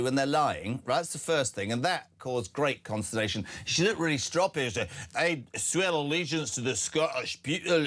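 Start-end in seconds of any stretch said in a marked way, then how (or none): 5.2-7.9 a light Scottish accent, imitating her oath; mock Scottish accent. Host mimics the MP's oath in a mock Scottish accent. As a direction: put on a bit of Scottish Braveheart accent. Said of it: "sound like Braveheart".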